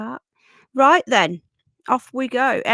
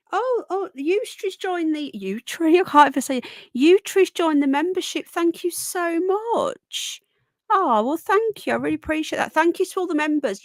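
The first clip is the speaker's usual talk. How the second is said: excited; 7 semitones higher, 4 dB louder than usual. Real, not acted. Thrilled at a new membership.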